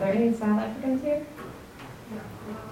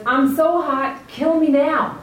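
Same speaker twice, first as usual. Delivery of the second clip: higher, louder, slow whiny complaint; 6 semitones higher, 5 dB louder, slower than usual. Retold or imitated. Yonah dramatically whines to God about the heat. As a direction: moan it dramatically.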